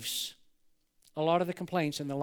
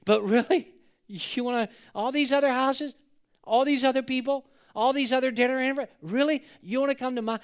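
acted incredulous delight, higher and louder; high-pitched astonished questions, tumbling on; happy disbelief; 4 semitones higher, 3 dB louder than usual. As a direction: gush like you can't believe you were picked. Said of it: "He's happy".

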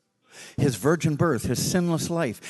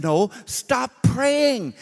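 slow, high, deliberate command; emphatic order; 9 semitones higher, 4 dB louder, much slower than usual. Retold or imitated. Preacher correcting the driver slowly and firmly. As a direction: spell out every word.

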